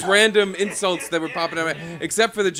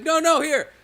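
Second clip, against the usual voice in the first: insistent; 10 semitones higher, 3 dB louder than usual. Retold or imitated. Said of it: "she's like".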